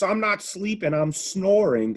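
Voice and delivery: high-pitched, loud mock of a sports pundit; mocking Stephen A. Sports pundit dismissing the Bucks, mocked in a high, loud voice. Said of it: "Stephen A, who's snoring on the Bucks".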